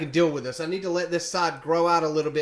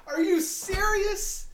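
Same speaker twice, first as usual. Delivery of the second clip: high, drawn-out incredulous question; retold disbelief; 12 semitones higher, slower than usual. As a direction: stretch it out high, as if you can't believe it. Retold disbelief at barber's remark.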